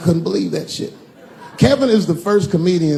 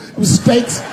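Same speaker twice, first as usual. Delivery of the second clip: excited ranting; 7 semitones higher than usual. Acted. Indignant rant about feast.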